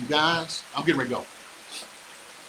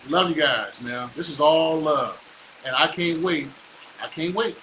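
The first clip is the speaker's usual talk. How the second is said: ordinary talk, nothing marked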